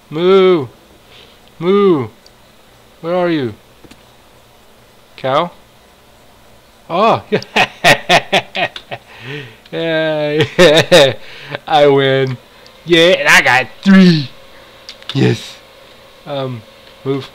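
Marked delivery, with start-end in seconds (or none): 0.1-2.1 mock moo. Imitating the cow he chases. 7.3-9.0 bright burst of real laughter; happy laughing fit; delighted laugh. Real, not acted. Laughing after catching cows. 9.7-15.6 loud, slowed gloating cheer; gleeful victory shout; triumphant. Real, not acted. Triumph after the hunt.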